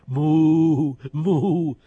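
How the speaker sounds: slow loud moo; loud drawn-out moo; cow moo. Acted. Cow shows how it moos.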